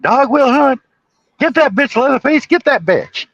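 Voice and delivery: high, loud mock villain cackle-voice; hammed-up high killer shout; crazed villain. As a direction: egg on Leatherface like a maniac. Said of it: "And he's like".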